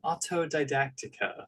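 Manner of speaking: high, soft prim Victorian maiden. Pretend. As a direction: say it like a delicate Victorian lady. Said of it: "say it like a Victorian maiden like that".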